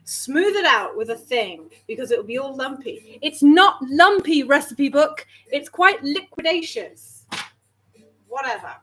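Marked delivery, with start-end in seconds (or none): 3.2-7.2 retort. Real, not acted. Talking back to recipe book.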